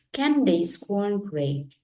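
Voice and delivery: usual speaking voice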